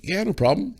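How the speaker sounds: very high-pitched, easy yes; high retold assent; imitated breezy agreement. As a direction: agree readily in a high acted voice. Actor readily agrees.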